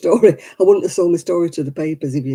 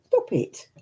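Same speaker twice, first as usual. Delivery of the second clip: whispered telling-off; 4 semitones higher than usual. Real, not acted. Hushed telling-off to the dog.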